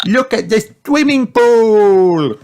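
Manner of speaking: very high, loud squeal; thrilled, drawn-out piglet cry; excited little Peppa. A narrator's reading. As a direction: stretch the last word in delight. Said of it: "said Peppa".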